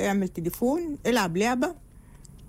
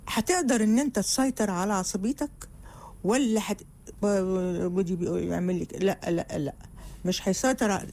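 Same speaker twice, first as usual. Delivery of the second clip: normal talking voice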